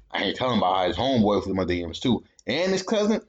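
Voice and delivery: usual speaking voice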